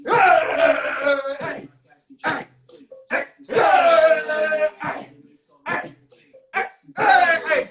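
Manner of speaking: loud, high hype-man yells; full-voice show-opening holler; hyped shouting. Real, not acted. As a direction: whoop up the crowd with yeahs and heys.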